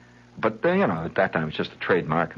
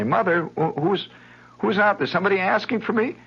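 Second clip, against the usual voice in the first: Patty calling out; 11 semitones higher than usual. Retold or imitated. Girl calls to her mother from next room, high and curious.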